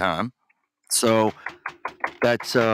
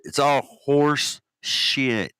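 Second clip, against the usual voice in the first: HORSE SHIT stretched slow and higher; drawn-out curse; 5 semitones higher, much slower than usual. Real, not acted. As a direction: drag out "horse shit" word by word.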